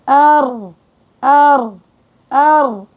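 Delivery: أر with a short, light tap of the tongue; correct ra demo. Shown as a demonstration. Teacher showing the correct ra to her students. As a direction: tap أر once, lightly, no trill. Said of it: "ما بروح بقول أر".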